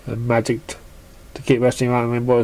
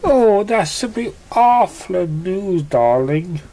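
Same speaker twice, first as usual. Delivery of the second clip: high, slow, theatrical lady voice; posh dismay in a high slow voice; Rarity; 6 semitones higher, slower than usual. A narrator's reading. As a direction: drawl it out like a dramatic fashionista. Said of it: "said Rarity".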